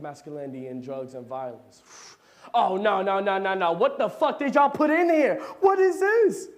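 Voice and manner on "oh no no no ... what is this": high loud alarmed yell; shocked outburst. Acted.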